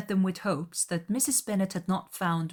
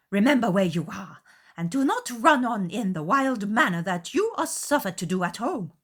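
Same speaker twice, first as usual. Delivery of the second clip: louder reproof of a daughter; scolding mother; 5 semitones higher, 6 dB louder than usual. A narrator's reading. Mother scolding Lizzie, louder and sharp. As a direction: rein in the girl sharply.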